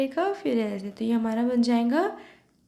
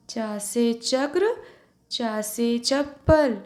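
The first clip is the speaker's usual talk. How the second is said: drawn out slowly and louder, every syllable spelled out; slow model reading; 4 dB louder, much slower than usual. Shown as a demonstration. Teacher leading the class drill, slow and louder. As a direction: say it slowly for pupils to echo back. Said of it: "repeat after me".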